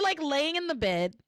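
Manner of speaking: ordinary talk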